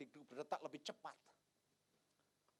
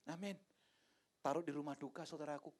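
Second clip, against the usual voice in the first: ordinary talk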